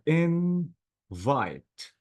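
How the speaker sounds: "invite" slowly split as in, vite; two syllables. Shown as a demonstration. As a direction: leave a gap after in of "invite". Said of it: "two syllables".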